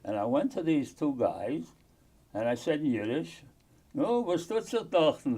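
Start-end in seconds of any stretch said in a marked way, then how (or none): 3.9-5.4 proud. Retold or imitated. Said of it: "in some proud way".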